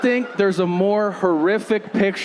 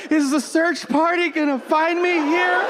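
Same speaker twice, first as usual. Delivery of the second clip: high, plaintive mock worry; worried woman; 10 semitones higher than usual. Trapped woman wondering about rescue, high worried voice. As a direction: pitch it up like someone stranded.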